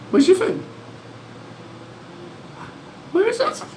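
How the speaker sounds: very high sing-song questions; high pet talk. Acted. Owner asking lorikeet where its food is, very high. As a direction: coax the bird playfully.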